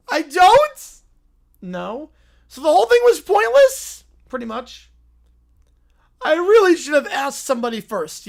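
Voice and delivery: high, surprised little bear voice; little bear jolted awake; high cartoon bear. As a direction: squeak it out like a startled cub. Bear realizes.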